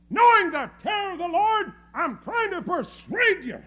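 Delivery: loud, high preacher's proclamation; full-force preaching. Real, not acted. Preacher urging people to avoid terrible judgment, full-force preaching. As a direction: thunder it out to the congregation.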